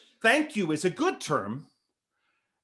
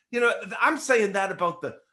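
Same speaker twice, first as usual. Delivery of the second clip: ordinary delivery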